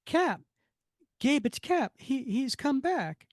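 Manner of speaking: hammed-up high voice of an astonished sweetheart; excited Peggy figure. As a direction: gasp out a recognition in a high voice. Voicing Peggy spotting Cap in comic.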